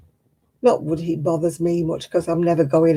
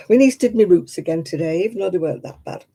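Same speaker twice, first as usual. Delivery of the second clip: normal talking voice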